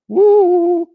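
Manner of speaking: high, loud, sustained "woo"; drawn-out, loud whoo on one held note; playful woo. Shown as a demonstration. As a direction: let out a long high-pitched woo. Imitates a wow-factor noise mid-sentence.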